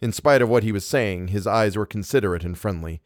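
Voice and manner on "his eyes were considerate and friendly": ordinary delivery, nothing marked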